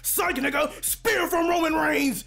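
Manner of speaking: loud, high wrestling yell; pumped-up shout; hype shout. Acted. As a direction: call the move like a ringside announcer. Wrestling-style tackle.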